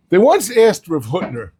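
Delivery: ordinary talk, nothing marked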